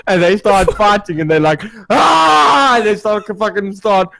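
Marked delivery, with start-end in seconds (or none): 1.9-2.8 long, high, loud war cry; mock battle scream. Drunk friends starting to play fight, yelling.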